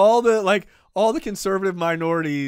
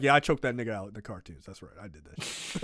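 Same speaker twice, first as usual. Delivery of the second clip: mock Kevin Conroy; 7 semitones lower, 7 dB quieter than usual.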